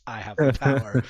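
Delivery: loud mock battle cry; son's imitated scream. Dad imitating his son with the He-Man sword, loud mock scream. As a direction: belt it out like an excited kid copying a toy. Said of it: "my son screams".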